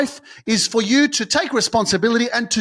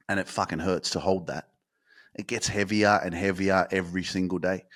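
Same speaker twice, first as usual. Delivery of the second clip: very low, soft and weighty; hushed, burdened low voice; heavy, pained hush; 11 semitones lower, 9 dB quieter than usual. Real, not acted. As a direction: sink low under the burden. Dramatizing the weight of held pain.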